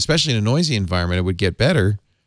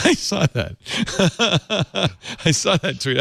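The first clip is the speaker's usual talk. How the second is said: laughing; 9 semitones higher than usual. Real, not acted. Laughing at a funny tweet.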